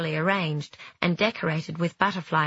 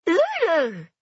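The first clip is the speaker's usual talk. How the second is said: put-on high excited baby dragon squeal; squeaky baby dragon; 12 semitones higher, 4 dB louder than usual. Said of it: "said the little dragon excitedly".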